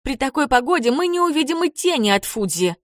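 indignant. A narrator's reading. Izumi complains they will not see Fuji, indignant. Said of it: "возмутилась Идзуми".

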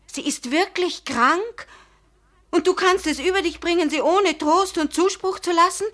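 high-pitched, shocked reproach; appalled reproach. Acted. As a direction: confront him, aghast. Appalled reproach about sick mother.

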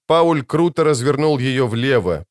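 plain reading voice

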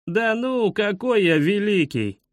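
high, embarrassed schoolboy; bashful. A narrator's reading. Schoolboy disclaims being the great painter, bashful. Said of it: "смутился Пашка Шишкин".

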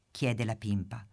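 ordinary delivery, nothing marked

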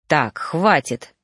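low, slow, exasperated cut-in; irritated. A narrator's reading. Reaper stops the ghost's story, irritated. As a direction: put a weary stop to it. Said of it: "раздражённо вздохнул Ноан".

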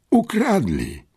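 very high, distressed cry; choked-out dismay. A narrator's reading. Boy realizing he was robbed, choked-out dismay. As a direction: blurt it out, voice leaping up. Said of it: "wykrztusił".

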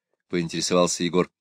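plain reading voice

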